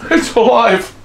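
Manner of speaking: high, thrilled gasp of delight; mock mad-scientist glee; excited. Real, not acted. Toy pumpkin lights up.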